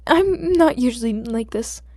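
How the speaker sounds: slow, loud, tearful; a crying plea of embarrassment; flustered, near tears. Acted. Flustered boy near tears excuses himself to the hero.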